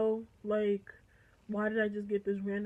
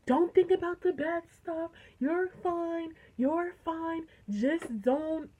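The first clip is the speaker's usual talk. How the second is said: higher-pitched, urgent mimicry of reassurance; protective friend; 3 semitones higher than usual. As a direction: coach them as the protective friend would. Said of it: "like, very protective".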